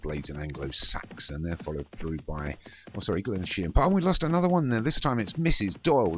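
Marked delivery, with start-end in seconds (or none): 3.8-6.2 sudden high, loud cry; startled exclamation. Real, not acted. Commentator interrupting himself as a horse falls, startled. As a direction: break off, alarmed at a fresh casualty.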